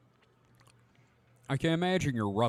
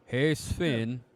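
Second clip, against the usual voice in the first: put-on Scandinavian accent; 4 dB louder, slower than usual. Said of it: "I just wanted to do the accent".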